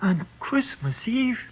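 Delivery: high, slow, stunned question; can't believe the date he just heard; shocked disbelief. Acted. Prisoner hears his execution date, stunned and high. Told he burns tomorrow.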